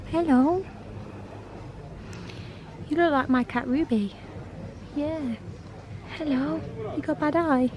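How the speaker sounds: high, soft pet-talk; sweet, high greeting to a cat; cooing to a cat. Real, not acted. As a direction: coo gently at a little animal.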